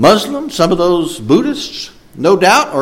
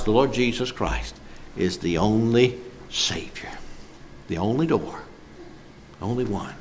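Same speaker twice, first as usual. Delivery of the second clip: hushed reverence; 4 semitones lower, 12 dB quieter than usual. Acted. Hushed closing of a point.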